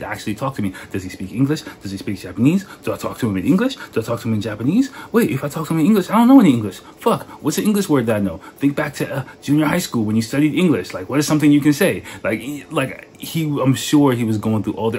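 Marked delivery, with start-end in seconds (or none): 5.1-12.0 high-pitched imitation of panicked thinking; mock flustered inner debate, pitch jumping up; working it out. Stranger planning how to talk to the foreigner, flustered. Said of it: "he's working out in his mind".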